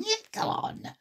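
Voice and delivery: high, soft coaxing; encouraging character voice; encouraging March Hare. A narrator's reading. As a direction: coax in a put-on squeak. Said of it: "in an encouraging tone".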